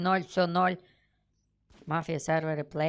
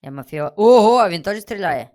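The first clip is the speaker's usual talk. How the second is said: ordinary talk, nothing marked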